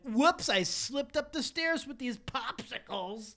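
high, slow, ditzy mock voice; mock a clumsy airhead. Mocking clumsy Caitlin.

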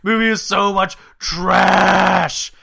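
loud furious rant, shouting; angry rant. Real, not acted. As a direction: yell it in disgust. Ranting about hating Blair Witch.

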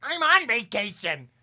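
a hint of Richard Dreyfuss; Richard Dreyfuss impression. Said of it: "Okay, can I do a voice?".

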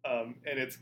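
ordinary talk, nothing marked

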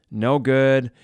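ordinary talk

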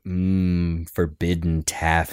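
drool over it in a low voice; savoring temptation. Pretend. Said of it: "you're like".

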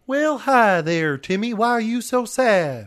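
high, slow folksy pitchman; hammed-up folksy ad voice. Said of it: "they've actually sent us a recording".

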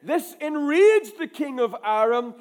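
usual speaking voice

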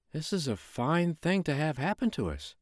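the wife fretting over the news; anxious high-voiced wife; wife's voice. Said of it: "said she".

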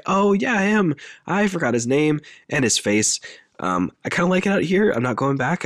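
high-pitched, offhand character voice; mock nonchalant. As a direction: act breezily unbothered, pitched well up. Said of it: "very nonchalant and deadpan way".

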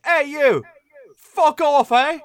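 shouted London yob voice; soccer hooligan. Retold or imitated. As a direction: bellow it like a football thug. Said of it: "soccer hooligan from Millwall".

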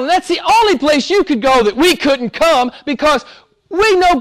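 high, smug mocking voice of the self-righteous; sneering Pharisee impression. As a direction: lampoon the proud religious men, way up high. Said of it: "They're mocking Jesus".

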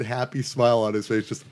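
ordinary delivery

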